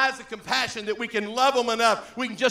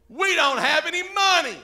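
high, loud mock whine; loud, high follower's protest; complaining disciples; 7 semitones higher, 8 dB louder than usual. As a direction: wail it in an imitated complaint.

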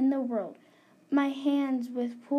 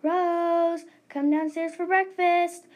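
calling up the stairs, louder and higher; 4 semitones higher, 5 dB louder, slower than usual. Acted. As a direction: call her down for breakfast. Mom calls upstairs.

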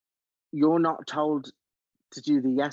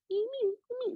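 high-pitched meeping; squeaky wordless mimicry of the runner; Beaker-like squeaks; 3 dB quieter, faster than usual. Guest likening the runner to a Muppet, high meeping squeaks. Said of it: "Like Beaker at the Muppets".

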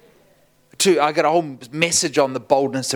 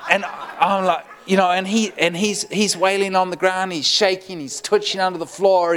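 ordinary talk

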